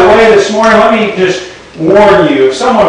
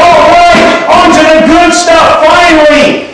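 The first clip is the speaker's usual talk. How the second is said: eager crowd; 9 semitones higher than usual. Retold or imitated. Bidders relieved, eager.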